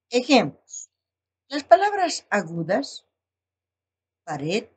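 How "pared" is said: "pared" stressed on the final "red"; stress on last syllable. Shown as a demonstration. Teacher giving an example of acute words, "pared" stressed on "red". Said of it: "la elevación de la voz en la última sílaba".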